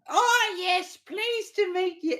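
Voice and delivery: put-on high, loud old lady voice; squeaky elderly woman calling out. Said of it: "this, like, very old, uh, woman".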